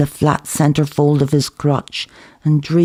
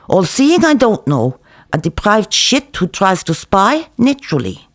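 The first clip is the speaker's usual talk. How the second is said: higher, lively café owner rant; Zeev ranting; 5 semitones higher than usual. Acted. Cook lecturing the narrator over kebab orders, lively. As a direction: rattle it off while dealing plates.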